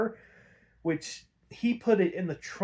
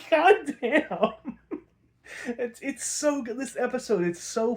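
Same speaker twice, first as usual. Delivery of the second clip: laughing; 9 semitones higher, 4 dB louder than usual. Real, not acted. Laughing reaction to the episode.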